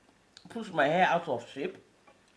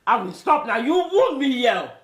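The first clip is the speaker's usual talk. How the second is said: wounded wail; 6 semitones higher, 6 dB louder than usual. Acted. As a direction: wail it like you've been stabbed. Mother wails after son's insult.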